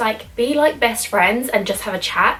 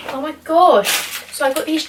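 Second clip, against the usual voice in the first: excited; 7 semitones higher, 3 dB louder than usual. Real, not acted. Tasting food, high and loud.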